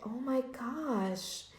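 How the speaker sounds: slow, very soft dismay; hushed shock. Retold or imitated. Woman retelling hearing her mouth is horrible, hushed shock. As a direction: breathe it out slowly, stunned. Retold shock at being criticized.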